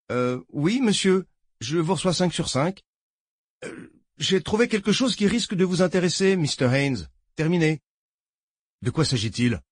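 a jittery lawman reporting in; nervous deputy voice. Acted. Deputy on the radio.

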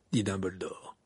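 usual speaking voice

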